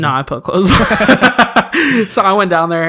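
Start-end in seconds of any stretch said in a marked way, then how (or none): 0.9-2.0 high burst of laughter; loud laugh. Real, not acted. Laughs at own joke.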